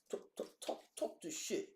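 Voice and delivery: breathy urgent whisper, egging herself on; whispered hype; hyped whisper. Real, not acted. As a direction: whisper it like a secret chant.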